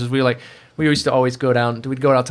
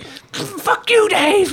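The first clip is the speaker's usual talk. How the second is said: imitating an angry yell, much higher; hammed-up furious retort, pitched way up; mock angry outburst; much slower than usual. Embarrassed coworker snapping at his friend, mock angry and high. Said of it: "He's like".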